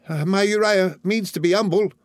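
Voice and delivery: very high, anxious mother; high-pitched humble entreaty; pleading mother's cry. A narrator's reading. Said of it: "cried his mother".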